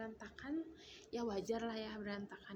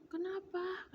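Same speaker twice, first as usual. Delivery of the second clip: higher-pitched retold worried question; alarmed question; 8 semitones higher, 3 dB louder, slower than usual. Mother responding to the child's scream, alarmed question. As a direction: ask it high, as if startled.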